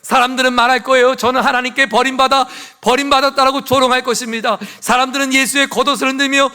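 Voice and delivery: high, loud impassioned sermon; ringing, high-pitched pulpit proclamation; full-force preaching. Real, not acted. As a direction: preach it out to the whole congregation.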